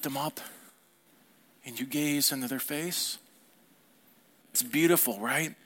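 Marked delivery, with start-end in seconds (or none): none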